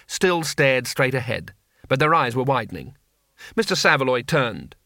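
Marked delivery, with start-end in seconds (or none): none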